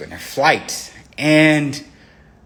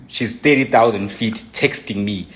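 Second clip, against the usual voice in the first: usual speaking voice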